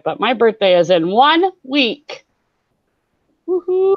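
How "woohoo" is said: excited cheer. Real, not acted. Birthday in one week.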